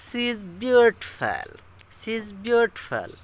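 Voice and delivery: "She is" linked to "shiz", "beautiful" clipped to "byootpel", slow British model; slow British-style reading with words run together; British English. Shown as a demonstration. Teacher showing how British people say it, slow. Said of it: "जैसे British लोग बोलते हैं ऐसे कहेंगे".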